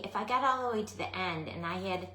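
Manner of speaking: ordinary talk, nothing marked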